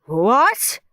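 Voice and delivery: loud, rising shocked what; gasped. A narrator's reading. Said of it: "gasped Mister Nurden".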